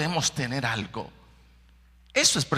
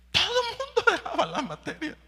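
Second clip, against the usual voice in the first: laughing aside. Real, not acted.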